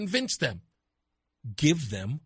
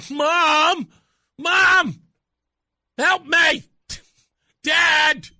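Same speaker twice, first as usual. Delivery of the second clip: high, loud mock cries for mom and dad; child yelling for help; 8 semitones higher, 7 dB louder, much slower than usual. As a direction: holler like a panicked kid. Children in trouble calling parents.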